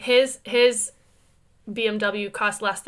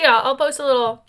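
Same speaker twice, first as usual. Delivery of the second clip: ordinary delivery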